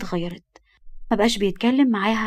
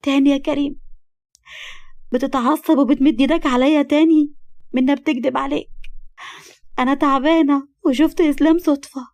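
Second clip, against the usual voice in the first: higher and louder, pleading through tears; tearful protest; 5 semitones higher, 4 dB louder than usual. Acted. Wife protests to her violent husband, tearful and loud. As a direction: cry out at him, hurt and desperate. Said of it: "قالت له ببكاء".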